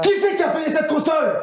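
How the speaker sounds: put-on high furious shout at his kid; angry father yelling. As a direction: blow up at the kid like an enraged dad. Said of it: "c'est celle qui gueule".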